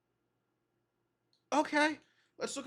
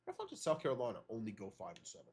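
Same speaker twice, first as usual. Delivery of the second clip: quick muttered aside; 14 semitones lower, 10 dB quieter, much faster than usual. Real, not acted. Quick aside after amazement.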